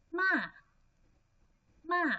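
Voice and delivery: MA falling sharply from high; fourth tone. Shown as a demonstration. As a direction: drop MA quickly from a high start. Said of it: "the fourth tone".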